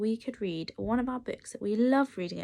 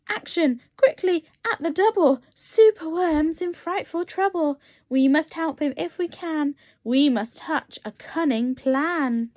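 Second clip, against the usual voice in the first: louder, fast call to action; urgent rallying call; 6 semitones higher, 7 dB louder than usual. Acted. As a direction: rouse the garden creatures to hurry. Creatures rally to save hero.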